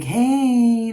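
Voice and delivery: high, loud breezy hey; a cheeky fake-innocent hello; casual mock greeting. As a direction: play it like nothing happened. Ex acting like nothing happened.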